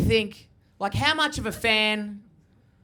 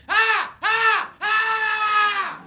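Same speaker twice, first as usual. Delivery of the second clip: yelled Ah; 12 semitones higher, much slower than usual. Retold or imitated. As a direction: whoop at them like a drunk. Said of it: "and goes".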